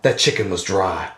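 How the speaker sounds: very low, understated parting shot; deadpan noir detective. Retold or imitated. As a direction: toss it off low and cool like a TV cop. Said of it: "he goes".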